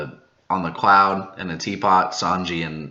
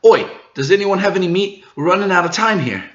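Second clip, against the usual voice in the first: higher, louder urgent call for meat; Chopper pleading; 10 semitones higher, 4 dB louder than usual. Acted. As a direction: ask the whole crew for food in a rush. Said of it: "Chopper's the one saying that they need meat".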